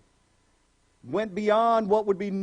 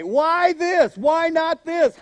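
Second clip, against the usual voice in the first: louder, higher, whiny questions; mock questioning; 6 semitones higher, 5 dB louder, much slower than usual. Preacher mimicking people nagging Jesus, whiny. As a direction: ham up the pestering.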